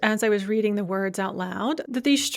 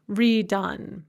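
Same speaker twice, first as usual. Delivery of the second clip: normal talking voice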